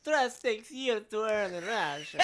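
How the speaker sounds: loud, slow, high Russian shout; hammed-up Russian accent. Shown as a demonstration. Friend hams up the Russian quote, shouting slowly. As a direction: belt the line like a hammy Russian villain. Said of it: "Ham that shit up".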